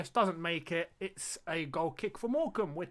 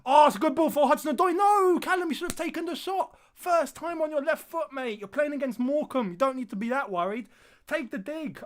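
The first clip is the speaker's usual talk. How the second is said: higher, louder urging the winger to shoot; frustrated shouting at the screen; 8 semitones higher, 6 dB louder than usual. Real, not acted. Streamer shouts at Callum for not shooting, frustrated yell. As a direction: yell at the player like a fan in the stands.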